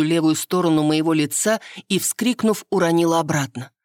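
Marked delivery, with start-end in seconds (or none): none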